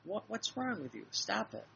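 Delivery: retold scolding. Speaker to a complaining woman, retold scolding. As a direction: cut off the complaining with mild exasperation. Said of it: "I said".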